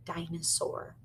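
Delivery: normal talking voice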